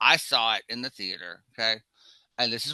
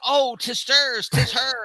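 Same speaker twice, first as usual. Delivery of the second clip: hammed-up Shakespeare line; 10 semitones higher, 6 dB louder, slower than usual. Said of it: "then they go".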